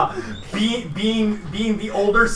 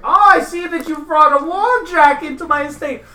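haughty commandant; 7 semitones higher than usual. Acted. Commandant mock alarmed at the Warjack in his estate, high and haughty. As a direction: play the host haughty and mock alarmed, voice cracking. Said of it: "that's not this fucking voice".

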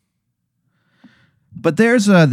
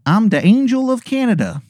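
higher, hammed-up Canadian accent; Angel of Canada, Canadian accent; 9 semitones higher than usual. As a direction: greet in a bad Canadian accent, pitched up. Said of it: "it is a horrible Canadian accent".

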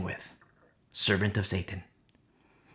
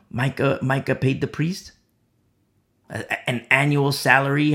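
ordinary talk

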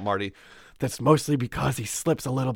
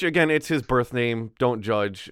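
ordinary talk

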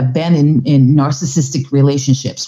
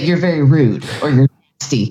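imitating Trump; 3 semitones lower than usual. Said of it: "he will say".

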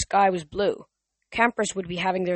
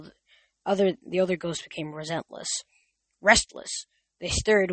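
plain reading voice

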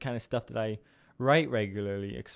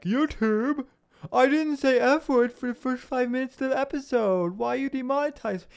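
high-pitched mock protest to YouTube; mock complaint; 13 semitones higher, 4 dB louder than usual. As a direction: plead your case in a silly high voice.

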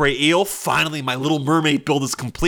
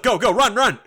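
1 urgent; 7 semitones higher, 4 dB louder than usual. Pretend. Urging animals to flee.

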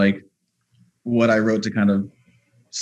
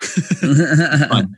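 laughing; 4 semitones higher, 4 dB louder than usual. Real, not acted. Laughing while saying it.

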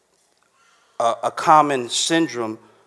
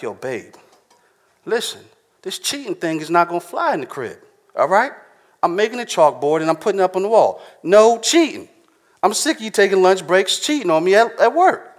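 pretend scolding husband; 6 semitones higher than usual. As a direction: lay down the law to the wife, building to a squeal. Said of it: "imagine if I had to tell my wife".

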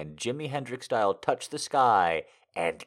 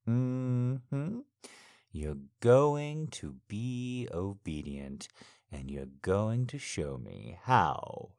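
seductive daddy. Acted. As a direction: coax her slowly, in control. Dominant daddy erotic roleplay.

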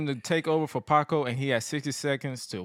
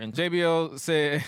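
normal talking voice

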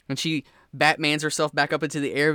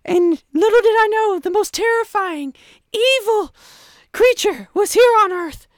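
grandiose villain monologue; 7 dB louder than usual. Acted. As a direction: gloat like a sci-fi mastermind. Villain monologue over intercom.

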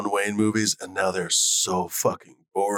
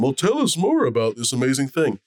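higher-pitched, lightly put-on TV interest; dumb studio audience; 4 semitones higher, 3 dB louder than usual. Shown as a demonstration. Host asking for the studio audience's sake, feigned interest. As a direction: lightly play the curious TV host. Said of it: "play the dumb studio audience".

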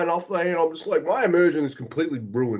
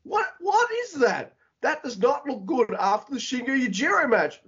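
exasperated quote. Retold or imitated. Fed-up fan whining at the wrestlers, high and incredulous. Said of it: "and I'm just like".

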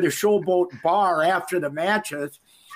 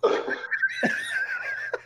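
very high, falsetto howl of a man hit with a mop; mock pained howl; 6 dB quieter than usual. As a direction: yelp like you just got whacked in the head. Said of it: "He went down to one knee and goes".

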